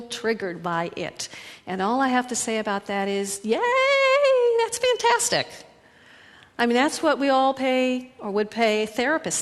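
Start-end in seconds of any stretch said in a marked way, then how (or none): 3.4-5.5 much higher, squealed yay; mock cheer. Coach cheers triggered feelings, high excited squeal. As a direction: celebrate it like great news.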